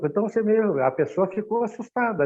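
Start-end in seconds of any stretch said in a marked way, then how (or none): none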